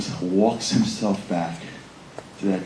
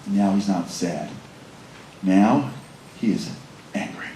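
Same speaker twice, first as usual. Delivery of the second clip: ordinary delivery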